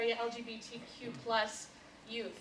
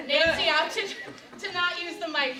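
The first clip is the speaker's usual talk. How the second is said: loud projecting classroom voice; teacher voice; 5 semitones higher, 8 dB louder than usual. Real, not acted. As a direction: project to the back row. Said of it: "I'm gonna whip out my teacher voice here".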